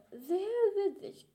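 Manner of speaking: sarcastic. Acted. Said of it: "sarcastic reply".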